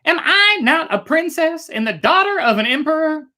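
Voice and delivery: high, loud pleading princess; urgent princess. A narrator's reading. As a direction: urge your father to let you go. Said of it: "urged the girl".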